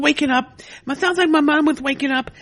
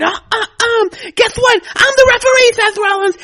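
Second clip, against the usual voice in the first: higher and much louder, triumphant reveal; gloating shout; 5 semitones higher, 7 dB louder than usual. Acted. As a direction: crow it like a sneaky villain. Slater gloats in retold match.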